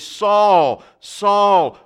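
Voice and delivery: loud, commanding call of the name; the voice from the light calling Saul by name; voice of Jesus calling. Acted. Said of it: "the voice is saying in the Hebrew language".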